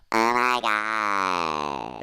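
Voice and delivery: very drawn-out, droning groan of words; mock-despairing, long-drawn mumble; mumbled to herself. A narrator's reading. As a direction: stretch each word way out, sliding down. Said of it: "mumbled her, to herself".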